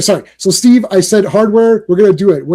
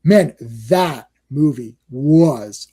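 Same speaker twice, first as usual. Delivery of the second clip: slow, low, drawn-out praise; emphatic awe; 6 semitones lower, much slower than usual. Real, not acted. Fan raving to co-hosts about a movie, slow emphatic awe. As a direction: stretch out each word in real admiration.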